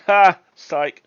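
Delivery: loud high jokey psych; spring the joke loudly; gotcha shout. Pretend. Friend springing a prank on Nisbet, loud high gotcha.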